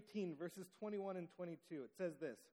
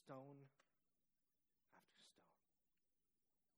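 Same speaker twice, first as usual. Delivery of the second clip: slow, whispered "after stone"; hushed whisper; 5 semitones lower, 19 dB quieter, much slower than usual. A narrator's reading. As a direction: breathe it out to draw listeners in. Hushed, slow build of suspense.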